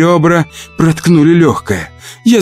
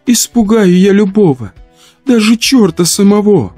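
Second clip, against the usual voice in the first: high, mournful rhyme; tearful moan; 12 semitones higher than usual.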